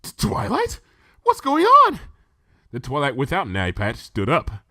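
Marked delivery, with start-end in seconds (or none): 0.0-0.8 stuttered, high-pitched startled question; falter, then squeak out the question; stammering. A narrator's reading. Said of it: "Rarity stammered". 1.2-2.0 very high, loud, bewildered cry; panicked high-pitched outburst; alarmed disbelief. A narrator's reading. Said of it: "Rarity stammered".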